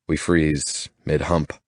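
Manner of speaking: usual speaking voice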